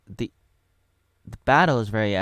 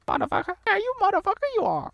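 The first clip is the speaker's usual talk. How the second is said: very high, fast cartoon cursing; high-pitched alien sage cursing; Yoda; faster than usual. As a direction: swear in a squeaky little creature voice. Parody Yoda swears.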